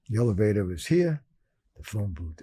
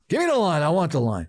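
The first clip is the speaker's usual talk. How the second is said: much higher, louder, pushy bark; exaggerated demand; 6 semitones higher, 4 dB louder than usual. Shown as a demonstration. Calling the bookie, mock pushy demand. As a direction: demand it like a desperate gambler. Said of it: "I wouldn't say it like that".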